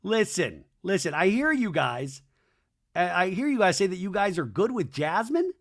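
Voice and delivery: ordinary delivery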